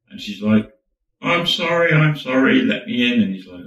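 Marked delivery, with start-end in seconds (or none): 1.2-3.2 high-pitched, urgent apology; apologetic pleading. Acted. Woman at the buzzer begs to come in, apologetic. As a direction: plead to be let in, starting high and loud. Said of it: "she's all apologetic".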